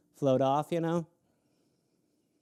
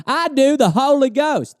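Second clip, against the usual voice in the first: higher, louder holy proclamation; mock pious; 8 semitones higher, 4 dB louder than usual. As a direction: announce it like a preacher. Loud punchline.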